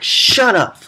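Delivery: put-on high, loud snap at the kids; grumpy yell. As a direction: bark it like a fed-up dad. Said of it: "grumpy guy's yelling at his kids".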